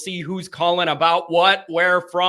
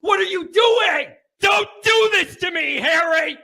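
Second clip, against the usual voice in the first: shouting in disbelief; 12 semitones higher than usual. Acted. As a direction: scream it out, betrayed. Yelling clip about football.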